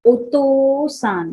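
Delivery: ओतो-सान with the o drawn out slowly; slow, careful ओतो-सान; prolonged vowel. Shown as a demonstration. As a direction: hold the long o in ओतो-सान. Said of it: "वो prolong है".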